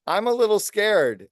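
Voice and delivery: high, slow, small scared voice; mock scared. Leader voices an employee up the ladder, mock frightened and high. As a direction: play a nervous climber admitting fear. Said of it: "have the confidence to say".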